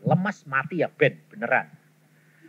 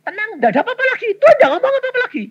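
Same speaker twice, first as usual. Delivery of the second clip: excited exclaim; 4 dB louder, much faster than usual. Real, not acted. Preacher exclaims, far higher and fast.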